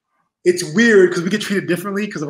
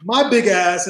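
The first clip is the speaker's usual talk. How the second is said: ordinary talk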